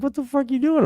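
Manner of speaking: high, disbelieving shouted question; mock buddies yelling. Retelling friends at the concert, in a high mock yell. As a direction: play his jealous friends calling out.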